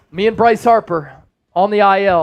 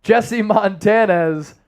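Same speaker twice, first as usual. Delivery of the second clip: ordinary talk, nothing marked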